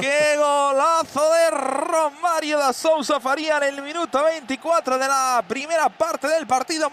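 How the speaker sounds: high, loud goal call; breakneck goal commentary. Real, not acted. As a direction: shout the goal with joy.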